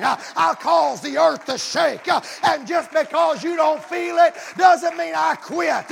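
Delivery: rhythmic sermon rising high and loud; full-force preaching. Acted. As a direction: drive each phrase harder toward a shout. Preaches as God to Elijah.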